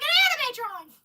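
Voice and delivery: high loud thrilled call; excited shout. Retold or imitated. Sister shouting about the drawing, excited.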